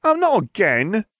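high, drawn-out wail; slow high-pitched girl's lament. A narrator's reading. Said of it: "Ginevra wailed".

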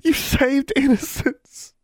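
very high, drawn-out tearful voice; slow, acted wail of thanks; weeping gratitude. A narrator's reading. Twin weeping as she thanks her sister's rescuer. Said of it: "Forbearance was weeping".

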